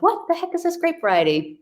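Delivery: high, puzzled retold outburst; baffled exclamation. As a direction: jump up in pitch, mock baffled. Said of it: "And I was like".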